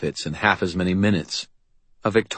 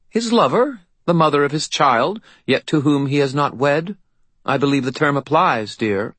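fairy godmother voice; 8 semitones higher, 3 dB louder than usual. A narrator's reading. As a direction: look down your nose at him. Leah answers Susan.

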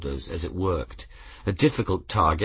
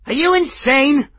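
very high, loud panicked scream; 9 dB louder than usual. A narrator's reading. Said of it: "screamed another patron".

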